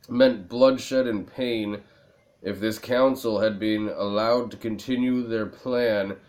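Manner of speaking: normal talking voice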